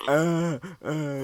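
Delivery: loud, high whiny groans; hammed-up wails of pain; mock pained moan. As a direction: imitate an overdramatic injured brother moaning. Imitating brother complaining of pain.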